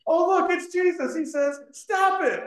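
high mock-exasperated preacher voice; hammed-up frustrated outburst; Honest Preacher. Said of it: "the Honest Preacher gets up there".

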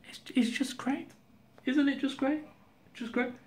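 high, mock-cheerful just great; sarcastic. Real, not acted. Ranting vlogger mocks his own bad luck, sarcastic and high. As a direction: sing out the sarcasm up high and soft. Sarcastic complaint about having no money.

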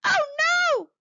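high, loud dismayed cry; cried. Acted. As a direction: squeal as the trap closes. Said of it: "cried Lobster".

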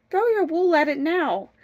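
high, loud order; urgent command. Acted. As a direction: call out the order mid-chase. Husband shouts orders in the chase.

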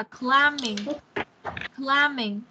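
climbing said as klai-ming, no b; slow model of climbing without the b; climbing, silent b. Shown as a demonstration. Teacher modelling the word for the child, no b. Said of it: "Mình sẽ không đọc âm bờ".

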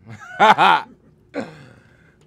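big real laugh, loud and high; loud burst of laughter. Real, not acted. Rapper hearing llama means gun, laughing loud.

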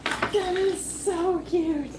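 slow, high-pitched gush; cooing over a cute gift; delighted gushing. Real, not acted.

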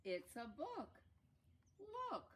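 high storybook answer; insistent character. Acted.

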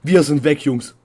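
high, very loud panicked yelling; panicked shout. Real, not acted. Gamer fleeing a scary room, shouting in panic. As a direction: yell while bolting out.

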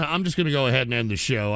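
ordinary talk

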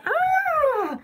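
high, drawn-out "ah" without much volume; mock startled scream. Streamer imagines scaring the radio announcer, mock scream. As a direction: imitate a scared announcer crying out. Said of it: "He'd be like".

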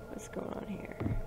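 whispered puzzlement; confused whisper. Real, not acted. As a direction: breathe the question, lost. Confused mutter.

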